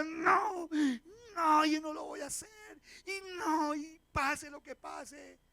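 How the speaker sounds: crying refusal. Retold or imitated. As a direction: refuse through tears, voice breaking. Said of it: "Y lloraba y decía".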